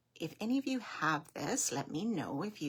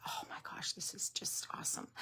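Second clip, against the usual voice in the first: soft unvoiced excited whisper; whispered delight; 6 dB quieter, faster than usual. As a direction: hush it like a secret thrill. Retold thought, hushed delight.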